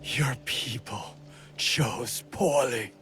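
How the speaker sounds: slow, strained half-whisper; halting, breathy final taunt; dying words. Acted. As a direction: force out your last words with failing breath. Defeated enemy's last words.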